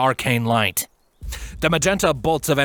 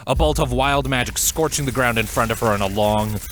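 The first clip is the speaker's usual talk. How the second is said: ordinary delivery, nothing marked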